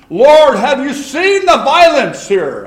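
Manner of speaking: loud, high, slow anguished plea; crying out. Acted. Habakkuk crying to the Lord, a loud slow lament. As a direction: cry out to God as a desperate prophet. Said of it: "he's crying".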